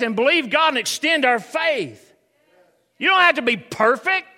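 loud, high, emphatic preaching; preaching at full force. Acted. Preacher declaring doubt does not disqualify deliverance, full-force preaching. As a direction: proclaim it to the whole congregation.

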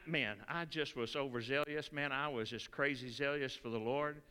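ordinary delivery

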